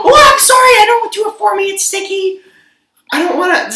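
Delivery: high, whiny mock customer voice. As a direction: squeak it out like the fussy customer. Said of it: "it's always saying".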